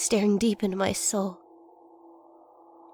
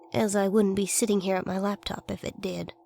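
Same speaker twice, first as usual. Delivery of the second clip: ordinary delivery, nothing marked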